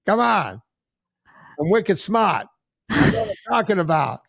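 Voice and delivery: put-on pushy Boston accent, ribbing. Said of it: "a Boston accent going".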